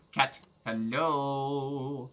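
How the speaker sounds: very slow, gentle hello to a cat; drawn-out gentle hello; slow soft greeting. Real, not acted. Meeting a cat.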